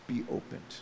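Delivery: "Be opened" in a soft whisper. Retold or imitated. Jesus speaks to hearts this morning, soft whisper. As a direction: breathe "Be opened" gently, like a touch on the heart.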